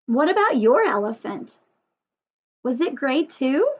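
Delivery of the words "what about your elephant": ordinary talk, nothing marked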